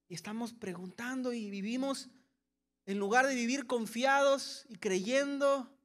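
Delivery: usual speaking voice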